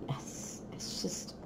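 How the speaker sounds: soft breathy whisper. Real, not acted. Peeking into the box, whispering. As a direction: hush as if sharing a secret.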